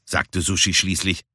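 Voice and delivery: usual speaking voice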